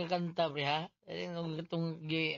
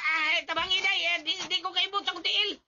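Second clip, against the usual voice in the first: higher, louder panicked pleading; panicked cry for help; 12 semitones higher, 9 dB louder than usual. Acted. Foot stuck in the basket, begging for help in a panic.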